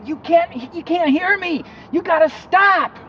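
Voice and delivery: high, loud pleading cry, slowing at the end; high desperate yelling, last words drawn out; desperate shout. Retold or imitated. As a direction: yell so they finally hear you. Pleading with cars in dream.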